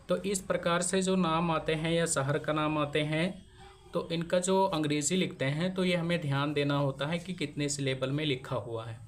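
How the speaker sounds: normal talking voice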